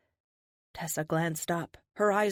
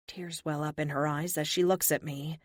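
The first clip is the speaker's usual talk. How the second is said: plain reading voice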